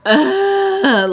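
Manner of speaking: loud, high "ugh" of exasperation; frustrated groan. Real, not acted. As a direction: let out a real groan of fury. Venting groan of fury at memory.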